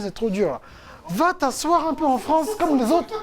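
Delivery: voix de mère nettement plus aiguë; maman imitée. Retold or imitated. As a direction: imite une maman qui sermonne son fils, haut perchée. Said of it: "ma maman a plein de fois dit". (imitation comique d'une mère africaine qui fait la leçon, voix aiguë)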